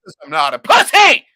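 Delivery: loud defiant yell; shouting. Real, not acted. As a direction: bellow it proudly. Drunk defiant boast.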